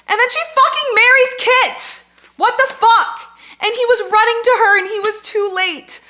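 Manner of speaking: high, very loud outraged wail; pissed-off yelling. Real, not acted. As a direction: scream the outrage out. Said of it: "I'm so pissed".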